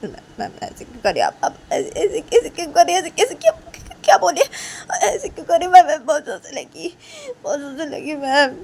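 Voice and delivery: high, loud sobbing protest; crying student. Acted. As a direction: wail it like a hurt schoolgirl. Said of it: "तभी काजल बोलती".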